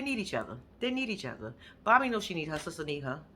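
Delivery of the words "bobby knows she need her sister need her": ordinary delivery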